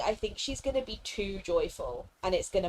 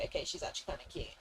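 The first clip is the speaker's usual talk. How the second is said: quick hushed aside, much softer; fast whispered admission; 6 semitones lower, 12 dB quieter, much faster than usual. Retold or imitated. Gamer admitting the character won her over, whispered fast. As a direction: whisper it fast like a secret confession. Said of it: "I was like".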